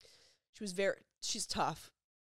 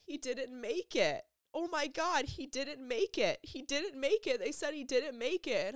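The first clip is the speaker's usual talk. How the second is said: high, distraught wail imitating her; retold sobbing; 8 semitones higher, 4 dB louder than usual. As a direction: re-enact her breaking the news, pitched up and anguished. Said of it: "Instantly sobbing".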